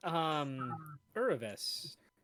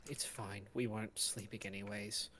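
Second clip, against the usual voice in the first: tired quiet voice; 5 semitones lower, 8 dB quieter than usual. Acted.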